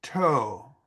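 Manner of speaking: TOE with a drawn-out "oh"; long O. Shown as a demonstration. Teacher breaking down octopus, TOE with a long O. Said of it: "long O".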